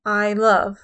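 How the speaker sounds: light "I", then punch "love"; iambic stress. Shown as a demonstration. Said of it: "unstressed syllable followed by a stressed syllable".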